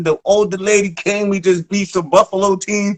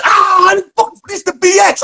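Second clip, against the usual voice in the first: high, hyped yell; retold scream; 11 semitones higher than usual. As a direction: scream it out, fired up. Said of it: "I'm screaming".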